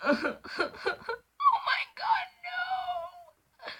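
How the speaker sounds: high, slow shrieking laugh. Real, not acted. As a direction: squeal with shocked laughter. Reader laughing in shock.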